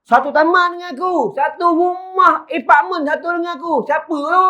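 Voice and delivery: high, wondering cries of astonishment; bewildered amazement. Retold or imitated. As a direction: voice the awed neighbours, pitched high. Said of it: "mereka pelik dan mereka terpinga-pinga".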